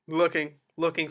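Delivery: usual speaking voice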